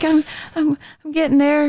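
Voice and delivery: high, timid imitation of the other person; mock shy. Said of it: "I'm a little shy".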